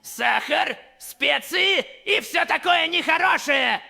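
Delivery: loud, gleeful menacing villain; shouting villain. Acted. Villain loudly taunting the one he'll eat, shouting. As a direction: bellow the threats with glee.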